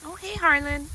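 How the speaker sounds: high, gentle greeting. Real, not acted. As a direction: coo hello. Greeting the goats.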